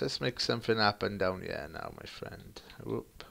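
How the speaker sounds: ordinary delivery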